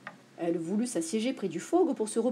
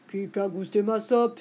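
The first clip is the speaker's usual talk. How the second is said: lightly voiced big bear, at usual pitch; a hint of Papa Bear complaining; big bear's cry. A narrator's reading. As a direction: ask it as the big bear, mildly indignant. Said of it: "s'écria Grand Ours".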